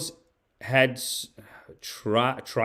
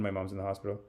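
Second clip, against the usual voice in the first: normal talking voice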